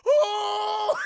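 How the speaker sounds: very high, loud shocked cry; a hammed-up cry of realisation; mock shocked oh. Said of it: "He's like".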